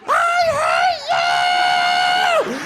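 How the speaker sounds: high shrieking yell; screaming brother. Retold or imitated. Kid brother shrieking at big brother mid-fight.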